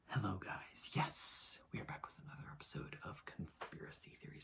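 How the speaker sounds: soft whispered imitation of an intro; Shane Dawson whisper. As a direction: breathe it like a hushed vlog intro. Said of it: "how's the Shane Dawson intro go?".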